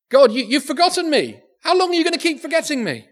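high, fast, urgent plea; paraphrasing the psalmist's plea to God, in a high, fast, pleading lament. Acted. Preacher voices the psalmist begging God, high fast plea.

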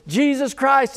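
high, loud call of the name; bold proclamation. Retold or imitated. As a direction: call it out over the crowd. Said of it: "it's kinda like I said".

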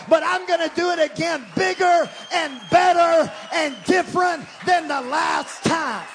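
high, loud preaching building to a shout; full-force preaching. Real, not acted. Preacher rousing the church that God is bigger, full-force preaching. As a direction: drive it up to a shouted climax.

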